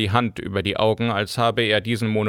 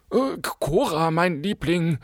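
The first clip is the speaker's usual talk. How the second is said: higher, pressing plea to his wife; urging husband; 8 semitones higher than usual. A narrator's reading. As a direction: nudge her gently but insistently. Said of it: "drängte ihr Gatte". (drängend zur Ehefrau)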